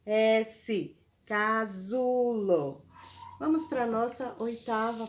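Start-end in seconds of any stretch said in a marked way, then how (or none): 1.2-2.8 Casulo drawn out slowly, every syllable clear; slow dictation. Shown as a demonstration. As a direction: spell it out slowly for the kids. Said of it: "apesar de ter o som de Z".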